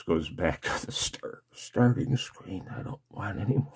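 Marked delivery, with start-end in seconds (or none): none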